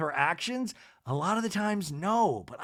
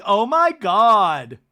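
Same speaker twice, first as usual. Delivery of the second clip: loud, slow mock-tearful wail; hammed-up meltdown, high and drawn out; Lisa; 5 semitones higher, 11 dB louder, much slower than usual. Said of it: "like, like, tearing up".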